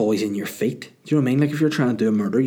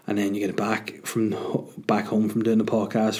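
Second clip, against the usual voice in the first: ordinary talk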